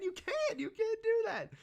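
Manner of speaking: squeaky falsetto pleading; mock protest. Fan tells Sasuke not to hurt Sanji, falsetto protest. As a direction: shoot up into a very high, playful whine.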